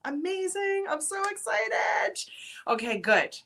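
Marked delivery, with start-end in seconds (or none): 0.1-0.9 amazing said much higher, thrilled; excited. Real, not acted. Host thrilled a viewer bought a ticket, excited. 0.9-2.2 high, slow delighted shout; excited shout. Real, not acted. Host cheering viewers who bought summit tickets, excited shout.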